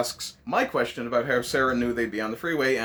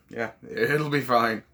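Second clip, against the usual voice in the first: put-on Scottish accent; 3 semitones lower, slower than usual. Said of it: "I can't really do a Scottish accent".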